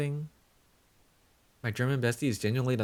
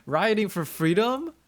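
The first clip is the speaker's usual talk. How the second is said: high and louder, hammed-up disbelief; mock shock; 7 semitones higher, 4 dB louder than usual. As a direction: feign outrage at the uprising. Mock alarmed at riot.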